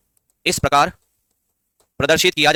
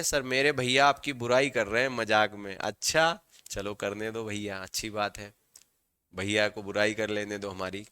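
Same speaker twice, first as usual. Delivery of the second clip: much softer, low-pitched aside to the chat; 7 semitones lower, 11 dB quieter than usual. Real, not acted. Teacher answering a student's comment about a brother, low quiet aside. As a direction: drop right down, low and quiet.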